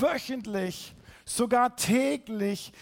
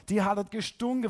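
usual speaking voice

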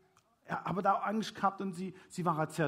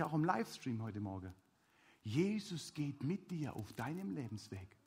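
usual speaking voice

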